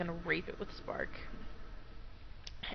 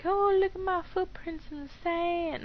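high, put-on delighted voice; silly high sing-song amazement; mock wonder; 10 semitones higher, 4 dB louder than usual. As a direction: marvel sweetly, pitched way up. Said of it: "I'm like".

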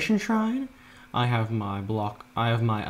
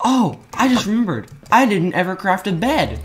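surprised gasp; 7 semitones higher, 6 dB louder than usual. Real, not acted. Suddenly remembers forgotten bed.

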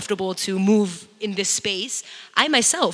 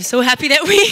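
happy laugh; 9 semitones higher, 9 dB louder, faster than usual. Real, not acted. Happy after choir success.

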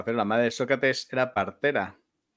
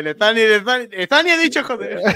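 high, loud excited outburst; 9 semitones higher, 8 dB louder than usual. Real, not acted. Delighted cry to friends over the slip. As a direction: shout it out, thrilled at the slip.

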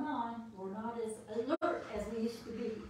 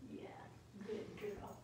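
whispered praise; 3 semitones lower, 9 dB quieter than usual. Real, not acted. Whispering praise to her dog during the demo.

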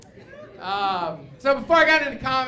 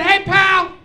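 high, loud confrontational call; puffed-up hey pal; mock tough comeback; 9 semitones higher, 11 dB louder than usual. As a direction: square up to the cowboy. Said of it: "I said".

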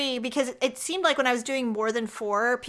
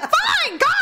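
exasperated; 6 dB louder than usual. Retold or imitated. Producer grudgingly giving in.

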